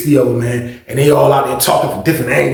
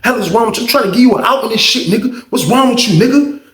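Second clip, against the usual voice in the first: higher-pitched mock telling-off; retold exasperated snap, pitched up; snapping; 8 semitones higher than usual. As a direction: imitate a fed-up friend scolding, without shouting. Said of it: "he snapped".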